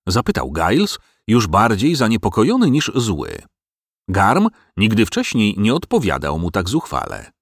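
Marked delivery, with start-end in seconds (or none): none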